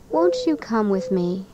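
ordinary delivery, nothing marked

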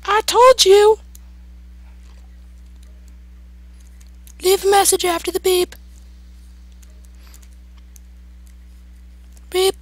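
very high falsetto taunting; mock-defiant falsetto squeak; squeaky put-on voice. Player taunting the monster locked outside, high squeaky put-on voice.

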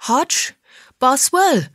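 high, loud call for missing pets. A narrator's reading. Agatha calls her missing cats at the back door, loudly. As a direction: call the names out into the dark. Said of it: "rief sie".

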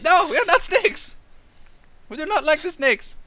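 very high cat-folk voice; high-pitched tabaxi denial; tabaxi character. Acted. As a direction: protest in a high put-on voice. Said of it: "Taxi say".

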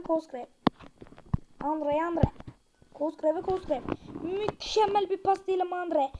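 usual speaking voice

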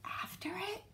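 breathy whisper question. A narrator's reading. Crew balking at the shark waters, whisper. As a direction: whisper it in dismay. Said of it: "we all whispered".

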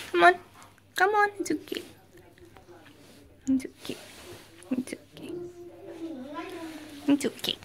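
soft, gentle reassurance to a nervous pet; soothing coaxing. Real, not acted. Owner coaxing her scaredy cat, soothing.

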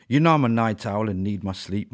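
plain reading voice

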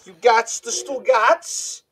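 slow, high mock Brooklyn accent. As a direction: ham up a Brooklyn wiseguy voice. Trading mock Brooklyn phrases.

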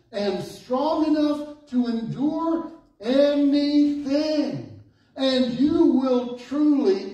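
slow, high, emphatic preacher's reading; stretched-out pulpit proclamation; forceful scripture preaching. Real, not acted. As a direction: proclaim each phrase, drawn out and high.

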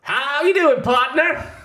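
cowboy drawl. Pretend. Mock cowboy greeting while joking about Audi. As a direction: put on a jokey cowboy voice. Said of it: "Still got it".

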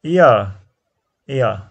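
ear said loud with a gliding ee-uh; diphthong ear. Shown as a demonstration. Teacher drills the class on ear, loud glide. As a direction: ear stretched as ee-uh. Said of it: "Yeah, diphthong".

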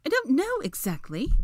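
high, exasperated answer; indignant retort. A narrator's reading. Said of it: "she spit back".

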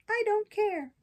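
high-pitched puppet defiance; defiant puppet. Acted. Puppet answering the fairy's warning, defiant.

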